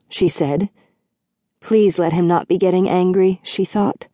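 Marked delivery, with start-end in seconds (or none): none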